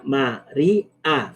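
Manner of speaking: MARÍA broken slowly into ma-rí-a; slow, separated syllables on María; split into syllables. Shown as a demonstration. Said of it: "eso puede formar".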